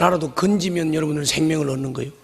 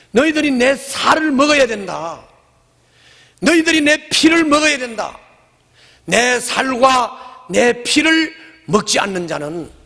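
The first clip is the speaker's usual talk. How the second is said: higher, proclaiming with weight; solemn declaration; 7 semitones higher, slower than usual. Acted. Jesus proclaiming to the crowd, raised and solemn. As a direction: proclaim each line as a command.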